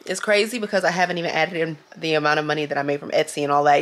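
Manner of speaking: ordinary talk, nothing marked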